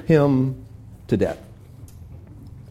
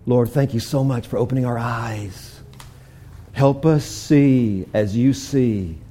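soft, slow prayer voice; hushed closing prayer; 7 semitones lower, 9 dB quieter than usual. Acted. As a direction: pray quietly to God.

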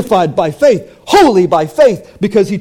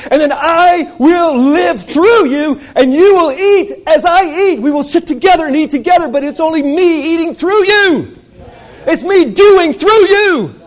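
preaching climax; 7 semitones higher, 3 dB louder than usual. Acted. Preacher at the climax of the sermon, high and ringing. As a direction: cry it out at full force.

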